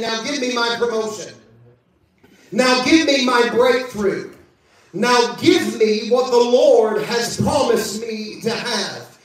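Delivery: normal talking voice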